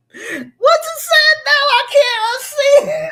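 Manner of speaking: loud, high shriek of real laughter; hollering with laughter. Real, not acted. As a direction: squeal it out through hard laughter. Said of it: "I'm hollering".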